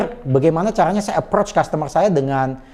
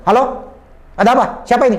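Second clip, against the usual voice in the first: curt phone answer; 5 semitones higher, faster than usual. Shown as a demonstration. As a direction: demand who is calling, rudely. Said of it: "nggak bisa kayak begitu".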